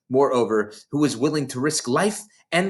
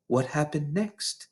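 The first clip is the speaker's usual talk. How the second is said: spellbound hush; 4 semitones higher, 7 dB quieter than usual. A narrator's reading.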